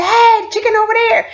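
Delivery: high, fast call across the yard. Retold or imitated. Inmate calling out to the yard, high and quick. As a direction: sing out the news. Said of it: "somebody said".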